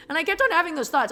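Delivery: normal talking voice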